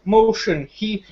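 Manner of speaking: ordinary delivery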